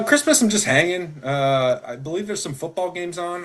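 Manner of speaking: normal talking voice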